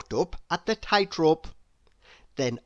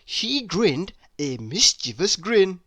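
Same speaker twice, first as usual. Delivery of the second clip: usual speaking voice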